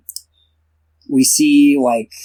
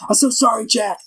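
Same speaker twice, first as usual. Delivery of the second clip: high-pitched, flustered apologizer; hurried, high mock apology; imitating apologetic Reed; 8 semitones higher, faster than usual. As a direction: put on a high voice and apologize profusely. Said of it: "Reed follows him. He's like".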